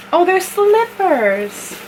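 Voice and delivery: high, slow cooing surprise; delighted squeal. Real, not acted. Unboxing, she coos at the slippers. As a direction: coo over the find.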